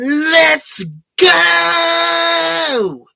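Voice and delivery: high, loud mock rallying shout; mocking Matt Damon's battle cry in a loud shout. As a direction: ham up a flat war cry. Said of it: "Matt Damon going".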